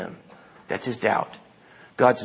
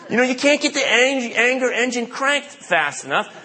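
Jonah's complaint; 10 semitones higher than usual. Acted. Preacher mocks Jonah's complaint to God, high whiny gripe. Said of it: "That's the kind of complaint that he's got".

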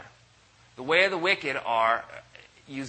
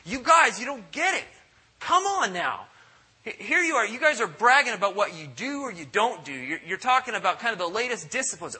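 exasperated plea; 7 semitones higher than usual. Retold or imitated. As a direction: plead with them like a fed-up apostle. Voicing Paul scolding Christians.

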